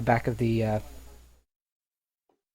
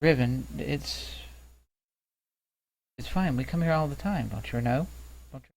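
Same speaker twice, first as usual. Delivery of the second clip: soft reassurance; 6 dB quieter than usual. Acted. Cal softly reassuring Riven as she heads for the door.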